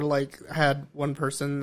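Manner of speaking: usual speaking voice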